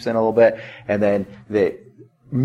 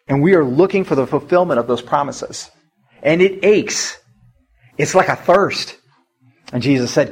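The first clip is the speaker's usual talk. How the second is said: ordinary delivery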